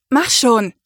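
ordinary delivery

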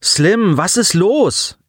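high, drawn-out call over a comm; metallic comm voice. A narrator's reading. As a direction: voice a boy hailing a friend, high and slow. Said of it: "erklang die metallische Stimme".

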